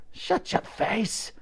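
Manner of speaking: slow, breathless hiss. A narrator's reading. Unseen gang kid hissing at Elsie to be quiet. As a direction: hiss it through the dark, menacing. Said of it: "hissed an unseen voice".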